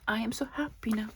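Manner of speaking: hushed, happy whisper; thrilled whisper to herself. Real, not acted. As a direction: confide it, barely voiced. Overjoyed at the English book.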